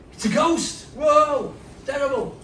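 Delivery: high, loud panicked shout of fear; frightened cry. Acted. Preacher voicing the terrified disciples, panicked shout. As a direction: cry out like terrified fishermen seeing a ghost.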